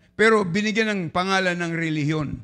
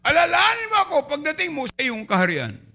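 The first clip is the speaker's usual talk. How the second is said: much higher, shouted plea to the Lord; pleading cry; 9 semitones higher than usual. Acted. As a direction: beg to be remembered. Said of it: "ang sigaw niya".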